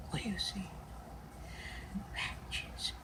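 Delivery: whisper. Real, not acted. Whispering to the cat.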